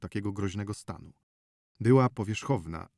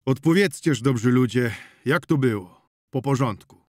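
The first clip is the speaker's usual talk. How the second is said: urgent question; 3 semitones higher, 8 dB louder than usual. Acted. Prokop asking the peasants for the full story, loud urgent. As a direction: demand the story in order. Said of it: "zapytał Prokop".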